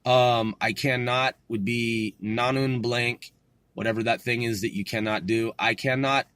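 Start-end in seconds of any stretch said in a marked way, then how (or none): none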